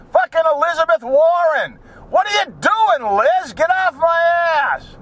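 high-pitched, hammed-up exasperated yell; mock road-rage yell. Driver yelling at an imagined politician riding the bumper, mock exasperation. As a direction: holler in mock outrage at a tailgater. Driver yells at tailgater behind.

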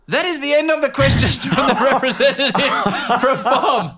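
high, loud, brisk imitation of an official; mock official announcement. Press officer ending the reporter's questions, mock loud announcement.

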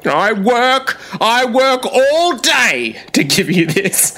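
high, drawn-out mock parental rant; angry parent. Co-host imitating every parent who's angry, mock indignant. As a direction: play the hard-done-by parent, hammed up. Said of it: "every parent who's angry".

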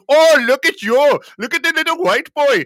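high, loud, teasing imitation Indian accent; jeering mock Indian accent. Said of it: "they had these Indian guys".

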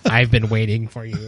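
low, mock-menacing robot; lightly voiced robot arm.

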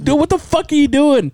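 high-pitched mock indignation; retold startled outrage. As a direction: act out a startled protest, pitched well up. Startled teen confronts intruding uncle.

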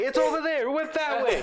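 high squealing panic, half laughing; panicked cartoon lady. Big coworker shrieking and fleeing the cat, high panicked squeal. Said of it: "like those fifties cartoons where".

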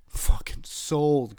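loud, high, drawn-out delight; exaggerated elated agreement; eager acceptance. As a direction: belt it out slowly, jumping at the deal. Retold eager acceptance of the fine.

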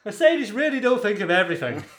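high, loud punchline; amazed Irishman. Acted. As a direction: marvel as the impressed Irishman.

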